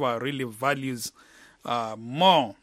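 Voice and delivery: usual speaking voice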